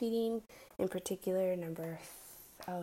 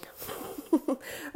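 laugh; 9 semitones higher, 5 dB louder than usual. Real, not acted. Laugh after joke.

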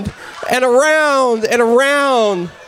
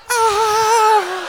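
put-on little girl's yelp; 8 semitones higher than usual. Little girl shrieks facing him.

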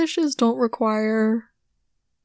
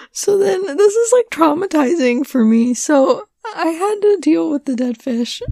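higher, louder, half-laughing wail; dramatic distress; 5 semitones higher, 5 dB louder than usual. Real, not acted. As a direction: wail it like a mock tragedy. Reliving traumatic fish cleanup.

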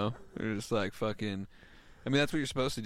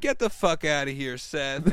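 mock yell; 4 semitones higher, 7 dB louder than usual. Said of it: "yell stuff back at him".

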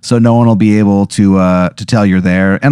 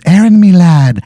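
put-on Irish accent; 10 semitones higher than usual. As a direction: play the merry Irish drunk, pitched way up. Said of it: "I don't know why I can't do the accent".

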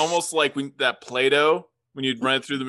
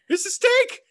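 excited offer; 3 dB louder, slower than usual. Acted. Offering steak to wolf.

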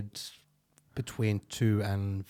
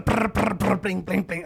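high, rolled trills and plinks; rapid rattling brr, then ringing blings; slot machine noises; 10 semitones higher, 5 dB louder than usual. Retold or imitated. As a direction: imitate a slot machine paying out.

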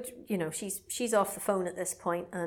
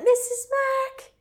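very high, slow, loud call of a name; shrill call; 8 dB louder, slower than usual. Retold or imitated. Neighbour's kid calling for Mrs Mac. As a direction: call out the name from far away, piercing. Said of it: "she's like".

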